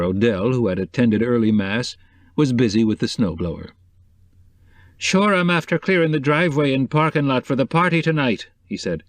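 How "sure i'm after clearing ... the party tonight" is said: lightly voiced Irish houseman, higher; a hint of a cheerful Irish handyman. Irish houseman Odell speaks.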